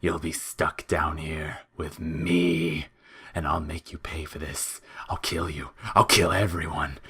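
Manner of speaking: a toothy monster promising revenge; menacing creature voice. A narrator's reading. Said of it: "his mouth turned toothy".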